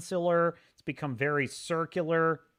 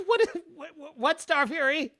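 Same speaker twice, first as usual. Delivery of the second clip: confused laugh; 10 semitones higher than usual. Real, not acted. Confused by a chat name.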